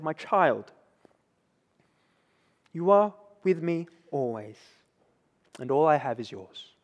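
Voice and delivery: soft, tender father. Retold or imitated. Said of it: "we hear with great tenderness".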